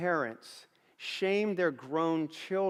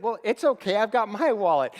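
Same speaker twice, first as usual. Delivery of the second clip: higher, louder acted-out husband; insistent retold reassurance, pitched up; retold urgent reassurance; 4 semitones higher, 6 dB louder than usual. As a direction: jump in to fix the problem, animated. Retold husband reassuring upset wife.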